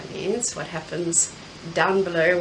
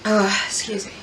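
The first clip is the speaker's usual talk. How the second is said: whispering. Real, not acted. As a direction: whisper it after a sneeze. Just sneezed, whispered apology.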